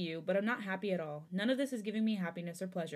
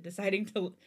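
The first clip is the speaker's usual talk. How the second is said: usual speaking voice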